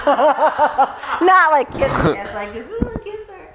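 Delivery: high, slowed, laughing; laughing while telling. Real, not acted. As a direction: giggle through the embarrassing bit. Laughing retelling of guys.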